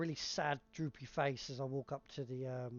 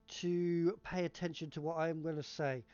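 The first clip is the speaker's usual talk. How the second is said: ordinary delivery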